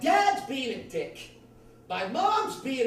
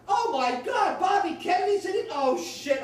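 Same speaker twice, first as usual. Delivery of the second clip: high-pitched mock dismay; panicked shock; 8 semitones higher than usual. McCarthy learns Bobby Kennedy's in it, high shocked outburst. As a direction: blurt it out aghast. Acted McCarthy hears of rival.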